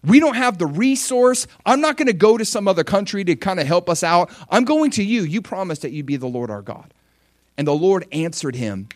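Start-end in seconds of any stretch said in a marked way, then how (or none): 0.0-6.9 higher, louder desperate plea to God; Asa's desperate prayer. Retold or imitated. King Asa pleading with God before an impossible battle, higher and louder. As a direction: cry out to God with nothing left. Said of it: "he says".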